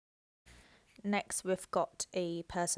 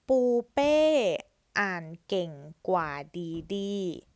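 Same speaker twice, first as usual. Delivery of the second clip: slow careful reading; 6 dB louder, slower than usual. Shown as a demonstration. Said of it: "reads the full sentence".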